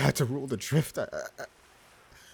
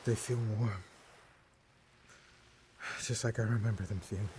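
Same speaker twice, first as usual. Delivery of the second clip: soft, low, sorrowful words; hushed grief; 4 semitones lower, 4 dB quieter than usual. Acted. Disgraced captain quietly grieving to Lily. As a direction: speak in aching quiet.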